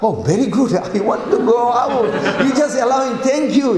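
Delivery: high, gleeful gratitude with a laugh; overjoyed. Acted. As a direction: act delighted at being thrown out. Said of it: "he was so happy".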